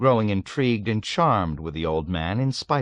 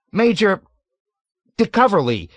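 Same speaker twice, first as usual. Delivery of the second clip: much higher, louder shocked cry; 12 semitones higher, 8 dB louder than usual. A narrator's reading. Nately recognises the major's name, crying out. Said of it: "he cried".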